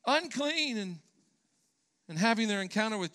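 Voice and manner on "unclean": lepers calling out, pitched high; high calling cry. Retold or imitated. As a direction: cry out a warning from a distance. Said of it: "yelling out".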